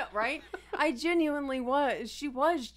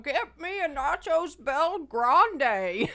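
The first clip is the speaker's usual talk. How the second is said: higher, louder, slow villain voice; menacing Bane ordering Taco Bell; 5 semitones higher, 4 dB louder, slower than usual. Retold or imitated. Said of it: "She'll start doing Bane".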